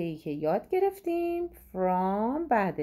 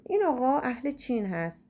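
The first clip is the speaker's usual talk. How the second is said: usual speaking voice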